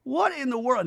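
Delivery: high, loud whiny imitation; hammed-up distraught wife; mock upset Brittany. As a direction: pitch it way up like an aggrieved rant. Mimicking Brittany's upset rant.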